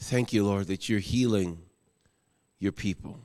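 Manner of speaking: low, soft, reverent prayer voice; closing prayer. Acted. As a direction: pastor praying over the congregation, hushed and low. Pastor leads closing prayer.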